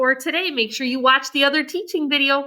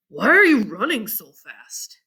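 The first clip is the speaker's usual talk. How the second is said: put-on lower, concerned young man; Abe; 5 semitones lower than usual. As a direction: ask gently like a kind older boy. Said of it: "Abe asked".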